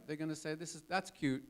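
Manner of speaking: usual speaking voice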